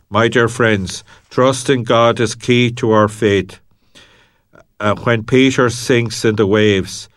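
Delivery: ordinary delivery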